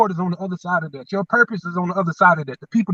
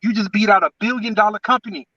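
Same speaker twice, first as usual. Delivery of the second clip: usual speaking voice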